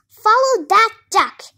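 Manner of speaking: loud, high, punched order; urgent command. A narrator's reading. Dodsworth to a taxi driver in a chase, urgent loud order. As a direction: call it out to the driver.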